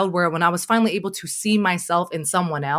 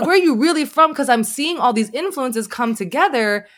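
hammed-up nosy asker, pitched up and pushing; mock probing question; 5 semitones higher than usual.

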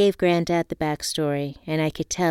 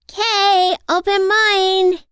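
put-on high, slow eager child cheer; 9 dB louder, much slower than usual. A narrator's reading. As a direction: cheer it out like an excited kid. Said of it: "Asia cheered".

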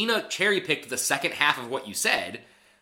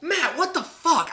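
incredulous; 13 semitones higher, 4 dB louder, slower than usual. Real, not acted. Outraged disbelief at Matt.

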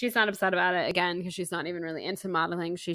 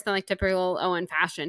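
normal talking voice

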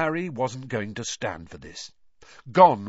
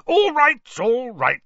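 much higher and louder, cutting him off; loudly; 14 semitones higher, 10 dB louder than usual. A narrator's reading. Panicky uncle silencing talk of broomsticks, loud. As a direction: shout it down before the word broomsticks. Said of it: "said Uncle Vernon loudly".

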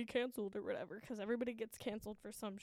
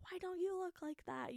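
nagging inner voice; 7 semitones higher than usual. Retold or imitated. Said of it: "voice in the back of my head".

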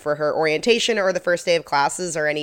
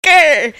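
mock growl; 10 semitones higher, 9 dB louder than usual. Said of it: "Her delivery of this episode is something else".

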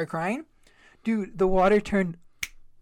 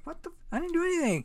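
high-pitched startled denial; startled protest; 12 semitones higher, faster than usual. Retold or imitated. Son denying to mom he broke anything, high startled. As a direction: squeak out your innocence. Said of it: "I was like".

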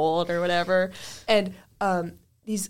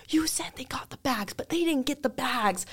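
higher, whispered mock complaint; angry customer, imitated; 5 semitones higher, 4 dB quieter than usual. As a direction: hiss the complaint back like the annoyed grandma. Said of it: "she was so angry".

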